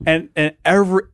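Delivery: ordinary talk, nothing marked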